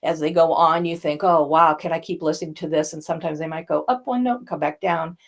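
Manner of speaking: low, quick one-note drone; monotone. Shown as a demonstration. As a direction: rattle it off on one flat note like a monotone speaker. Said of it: "that one note that they stay on".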